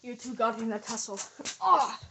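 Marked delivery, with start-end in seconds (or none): 1.6-2.0 high, loud short yell; cry in a struggle. Acted. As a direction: yell out mid-tussle. Cry during a tussle.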